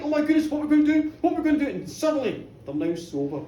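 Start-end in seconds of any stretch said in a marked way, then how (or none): 0.0-1.7 fast, high panicked gabble. Acted. Couple panicking over the body, fast high gabble. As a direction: babble in a panic. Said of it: "And in a panic".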